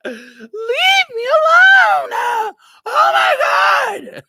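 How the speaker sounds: hammed-up bawling at the top of the voice; crying. Overwhelmed boy to his stepdad, mock hysterical crying. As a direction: stretch every word into a high, loud mock wail. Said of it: "that I just start crying".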